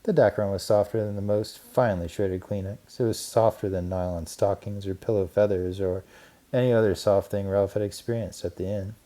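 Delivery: usual speaking voice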